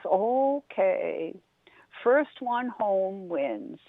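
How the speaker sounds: very slow, unbothered turtle; drawn-out and content, in no hurry; slow calm turtle. Acted. Turtle turning down Rabbit, very slow and calm.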